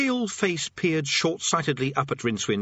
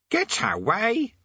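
high, drawn-out parrot squawk; disbelieving parrot; 12 semitones higher, much slower than usual. A narrator's reading. Parrot told the statue is a god, squawking disbelief.

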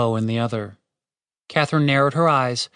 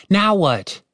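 exasperated; 6 semitones higher, 3 dB louder than usual. Acted. Catherine annoyed by barking.

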